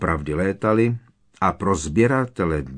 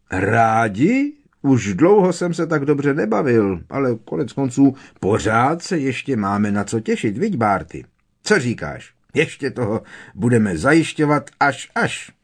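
lively, boisterous character voice with leaping pitch; jovial Pitloun, exclaiming; 5 semitones higher, 4 dB louder than usual. A narrator's reading. Pitloun, astonished, tells Barty how much fun it is, exuberantly. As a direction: bubble over with hearty enthusiasm.

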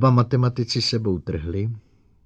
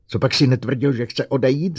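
high, loud challenge; roar; 6 semitones higher, 6 dB louder than usual. A narrator's reading. Bogert challenges the silent robot, roaring. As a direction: roar the question at them. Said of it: "zařval Bogert".

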